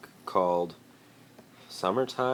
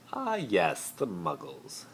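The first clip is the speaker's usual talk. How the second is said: higher, then a breathy sigh of boredom; 6 semitones higher, slower than usual. Acted. Malfoy sighing about Harry's relatives, bored. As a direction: sigh out the last word. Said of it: "Malfoy sighed".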